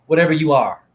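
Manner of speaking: ordinary delivery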